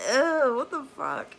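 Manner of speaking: loud, high grossed-out ew; mock gross-out squeal; disgusted. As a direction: recoil in disgust. Retold disgust at rinse.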